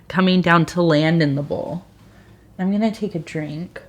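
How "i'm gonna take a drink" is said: hushed aside before sipping; quiet aside. Real, not acted. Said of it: "I'm trying to be quiet".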